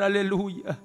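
ordinary talk, nothing marked

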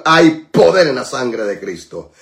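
low, reverent preacher's proclamation; solemn declaration. Real, not acted. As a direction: let the phrase sink down devoutly. (proclamación solemne con voz grave)

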